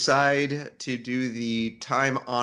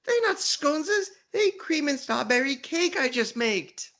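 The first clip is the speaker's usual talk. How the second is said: put-on very high, babyish child voice; little child voice. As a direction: squeak it out like a tiny kid.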